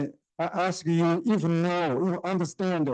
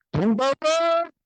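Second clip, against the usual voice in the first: put-on Mao Zedong's Hunan accent; 10 semitones higher, 3 dB louder than usual. Lecturer imitating Mao proclaiming the republic, strong Hunan accent. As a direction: declaim it like Mao in heavy Hunan-accented Mandarin. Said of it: "I imitate it as a Mao Zedong's accent".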